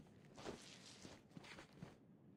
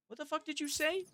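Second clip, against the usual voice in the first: squeaky high confused what the; baffled outburst. Retold or imitated. Streamer mocks his own reaction to the meme, squeaky baffled. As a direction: jump way up in pitch, baffled.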